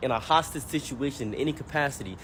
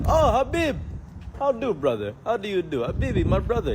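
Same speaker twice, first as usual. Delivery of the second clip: put-on Arabic accent; 5 semitones higher than usual. Comic imitating the friendly Arabic store owner in his accent. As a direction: greet him like a beaming Arabic shopkeeper.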